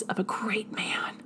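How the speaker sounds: whispered phrase within a reading; hushed theatrical reading; dramatic whisper. Acted. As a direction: drop to a whisper for effect.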